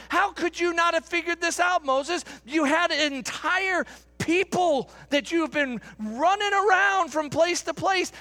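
high, insistent demanding voice; complaining Israelites. Acted. Preacher voicing the Israelites complaining to Moses, high and demanding. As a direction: demand water like an angry crowd.

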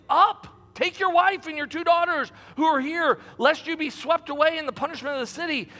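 high, urgent scripture reading; urging. A narrator's reading. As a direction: press them to get up and go. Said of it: "the angel urged Lot".

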